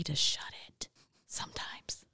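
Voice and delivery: laughing through a whisper; breathy, giggling self-teasing aside. Real, not acted. Self-mocking aside about talking too much.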